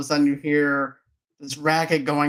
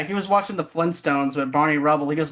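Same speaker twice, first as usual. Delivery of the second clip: ordinary delivery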